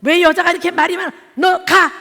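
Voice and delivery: father shouts at daughter to go, mock angry yell. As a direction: imitate a father barking at his daughter. Husband yelling at daughter.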